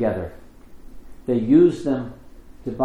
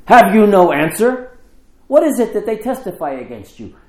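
loud, high accusing challenge; indignant priest calling out the accused; demanding high priest; 8 semitones higher, 8 dB louder than usual. Acted. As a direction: confront him hard with the question. High priest confronts silent Jesus.

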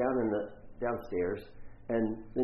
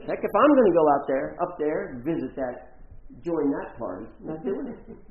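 indignant refusal; 3 semitones higher, 9 dB louder than usual. Retold or imitated. Acted indignant refusal to join party.

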